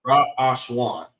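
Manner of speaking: Ra, Ash, Wan, each syllable distinct; Ra, Ash, then Wan dropping at the end; three syllables. Shown as a demonstration. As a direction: sound out Ra... Ash... Wan for a learner. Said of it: "Three syllables".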